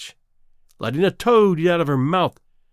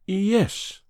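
trembling voice; 5 semitones higher than usual. Acted. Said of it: "in a trembling voice".

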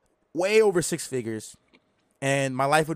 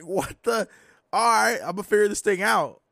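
excited disbelief; 7 semitones higher, 5 dB louder than usual. Retold or imitated. Shocked at nephew's profits.

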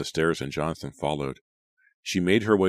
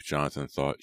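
ordinary delivery

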